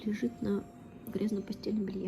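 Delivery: hushed so the dog sleeps; whispering. Real, not acted. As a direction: whisper so you don't wake him.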